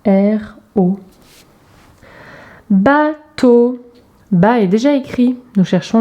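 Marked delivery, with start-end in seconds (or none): 0.1-1.0 «R O», lettre par lettre, bien détaché; épelez «R O» clairement pour les enfants; lettres épelées. Shown as a demonstration. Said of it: "la syllabe ro". (épeler «R O» à voix posée)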